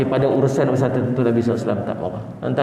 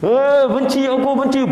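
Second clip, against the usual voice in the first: marah; 10 semitones higher than usual. Acted. Child angrily hammering nails.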